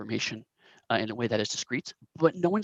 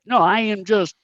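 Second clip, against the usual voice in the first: thick, obviously fake Korat accent, pitched up; louder, higher hammy Korat accent; 6 semitones higher, 7 dB louder than usual. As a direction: play a bad put-on Korat accent. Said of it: "very thick, um, Korat accent".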